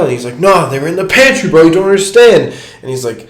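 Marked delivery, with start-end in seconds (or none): 0.4-2.5 high, loud mock rant with a laugh; mock furious. Drunk frat leader ranting at the homie about his pantry, mock furious. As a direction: ham up the raging frat guy.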